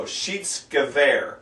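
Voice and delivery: slow "Schiedsgewehr" with "sheets" at the start; long E. Shown as a demonstration. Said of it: "you pronounce the long E sound".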